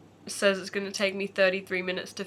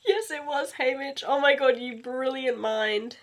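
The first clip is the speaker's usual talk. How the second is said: excited; 5 semitones higher than usual. Real, not acted. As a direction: burst out in delight. Excited realisation.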